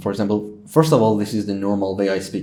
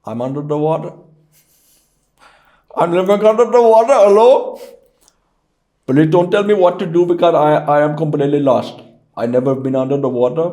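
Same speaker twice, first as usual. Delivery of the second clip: high, rapid, hammed-up Indian accent; Indian or Pakistani accent; 6 semitones higher, 6 dB louder, faster than usual. Shown as a demonstration. Lost caller living under the water, Indian accent. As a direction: plead urgently in a put-on Indian accent. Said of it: "also the Indian or Pakistani accent".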